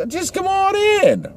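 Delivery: very high, slow, drawn-out invitation; very high, slow hostess finally inviting the vampire in; church lady voice. Retold or imitated. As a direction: coax her over the threshold, sing-song. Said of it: "the one lady says".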